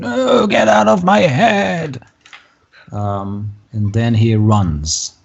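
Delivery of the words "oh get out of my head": high, drawn-out cry; acted high wail of distress; screaming in torment. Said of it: "starts screaming".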